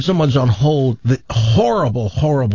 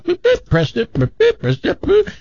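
mock beeps. Imitating menu beeps.

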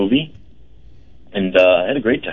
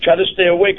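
higher mimicking of friend; 8 semitones higher than usual. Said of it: "And you said".

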